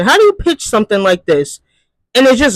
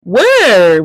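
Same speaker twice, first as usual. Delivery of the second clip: ordinary delivery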